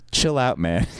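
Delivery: chuckling casually through the words; amused, brushing off the panic; laughing. Retold or imitated. Insurance agent laughing at the panicked caller, amused.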